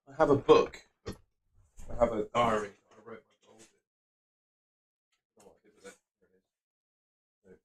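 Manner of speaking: drifting off mic to find it; fading mutter. Real, not acted. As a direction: murmur away from the mic. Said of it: "searching for his book".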